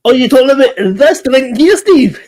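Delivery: a delighted laughing question; a squeal of delight over the drink. Real, not acted.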